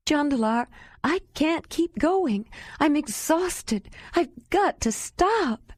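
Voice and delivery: high, slow, breaking voice near tears; sobbing plea. A narrator's reading. Exhausted woman on the ice, sobbing. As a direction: Ayla begging Jandalar to stop, sobbing. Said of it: "Ayla said with a sob".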